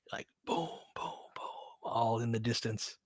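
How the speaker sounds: soft unvoiced booms trailing into a whisper; whispered gunfire noises. Describing the neighborhood to listeners, whispered distant booms. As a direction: hush it like shots heard far across the city. Imitating far-off gunfire at night.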